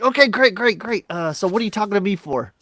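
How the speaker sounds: over-agreeable phone rep; chipper agent. Retold or imitated. Agent puzzled why the caller rang, chipper.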